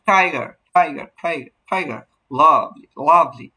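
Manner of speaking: Tiger and lovely, heavy first syllable, weak second; stress on first syllable. Shown as a demonstration. Teacher demonstrating a trochee, strong-then-weak tiger and lovely. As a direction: punch টাই and লাভ, let the endings drop. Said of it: "pressure পাবে বেশি".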